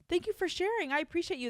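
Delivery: touched and happy; delighted. Real, not acted. Host thanks caller warmly.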